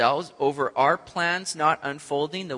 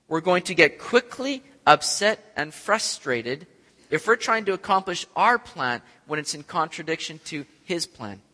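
ordinary delivery

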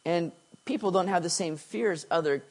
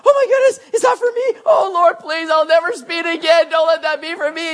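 much higher, louder mock panic; freaking out; 11 semitones higher, 8 dB louder than usual. Driver spotting flashing lights, hammed-up panicked pleading. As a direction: play the speeder begging not to be pulled over. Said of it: "you start freaking out".

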